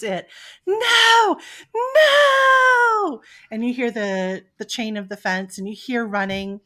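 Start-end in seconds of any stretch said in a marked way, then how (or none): 0.7-3.2 high, loud cry of no; desperate screams. Acted. Humans screaming as the coyote takes their dog, loud and desperate.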